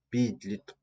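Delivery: "підліт-" drawn out slowly with stress on ПІД; first-syllable stress. Shown as a demonstration. Teacher models the stress for pupils, slow "підліт-" stressed on ПІД. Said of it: "На перший склад".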